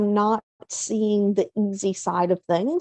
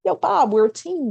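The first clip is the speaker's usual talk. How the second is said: upbeat team cheer; 7 semitones higher than usual. Retold or imitated. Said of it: "It's like".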